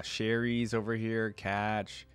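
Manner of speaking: usual speaking voice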